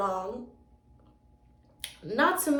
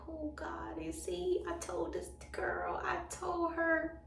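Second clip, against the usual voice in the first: high, hushed panic; soft, anxious retold muttering; panicked thought; 6 semitones higher, 9 dB quieter than usual. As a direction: fret under your breath, pitched up. Retold panicked thought, heart pounding.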